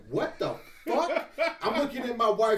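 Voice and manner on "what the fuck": stunned disbelief. Retold or imitated. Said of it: "I'm like".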